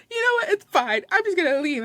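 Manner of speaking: high-pitched tearful huff; mock crying. Crying customer storming off from staff, mock crying. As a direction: mimic a weepy customer giving up. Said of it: "She's crying".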